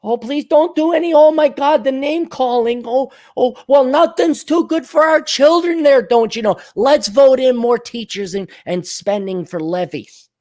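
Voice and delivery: high, fretful Minnesota caricature; mock Minnesotan accent. Timid Minnesotan dreading name calling, high mock Minnesota accent. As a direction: plead meekly in a hammed-up Minnesota accent.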